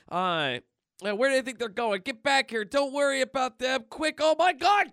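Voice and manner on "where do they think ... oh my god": high, loud, frantic henchmen; hammed-up cries of alarm; panicked shouting. Panicked goons voiced.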